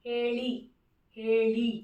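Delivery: careful, separated హేళి, long e then retroflex ళి. Shown as a demonstration. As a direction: model హేళి for learners, tongue curled back on ళి. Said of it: "అలా పలకాలి, not లా".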